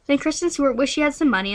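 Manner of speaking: ordinary talk, nothing marked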